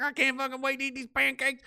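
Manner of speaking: high, gleeful voice for the pancake eater; mock greedy Kenney. Mocking the premier over his pancake breakfast, in a high gleeful voice. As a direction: ham up a man who can't wait to eat. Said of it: "He's like".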